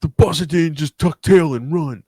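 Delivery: slow, dramatic movie-line read; hammed-up action-movie quote; quoting the movie line. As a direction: deliver it like a tough-guy hero. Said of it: "I fucking love that line".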